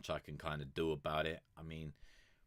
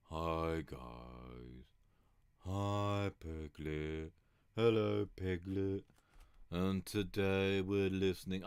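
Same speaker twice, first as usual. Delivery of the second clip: Eeyore voice; much slower than usual. Retold or imitated. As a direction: drag it out like gloomy Eeyore. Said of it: "the other one just sounds like Eeyore".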